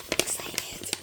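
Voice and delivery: hushed whisper to herself; excited whisper. Real, not acted. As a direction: whisper it, full of anticipation. Excited aside before opening number two.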